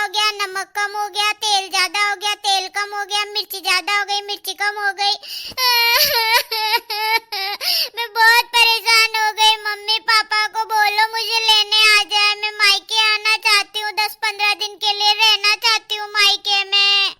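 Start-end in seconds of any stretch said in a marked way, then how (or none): 4.2-7.9 fake crying. Daughter fake-cries to mother on phone. 8.0-17.1 whiny pleading. Acted. Daughter whines to mother to fetch her.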